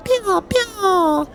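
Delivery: very high, cute squeaky chirping; creature chirps. Acted. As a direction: squeak like a happy little bug. Voicing pet's happy chirp.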